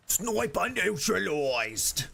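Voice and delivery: slow, high, hammed-up Aussie-English; exaggerated Aussie-English call-out, drawn out; put-on Aussie-English accent. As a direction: stretch the words in a mock Aussie-English accent. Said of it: "It's a very good accent".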